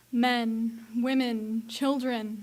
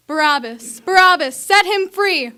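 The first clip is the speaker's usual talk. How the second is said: loud chanting shout, building higher; crowd shouting; 6 semitones higher, 13 dB louder than usual. Acted. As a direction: yell it out with the mob. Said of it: "the voices were blending together".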